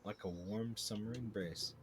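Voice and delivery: ordinary talk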